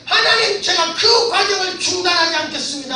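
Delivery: high, loud, impassioned vow; shouting to God. Retold or imitated. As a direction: cry out a promise to God. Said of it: "하고 소리치기 시작했어요".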